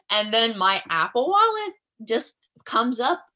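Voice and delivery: ordinary talk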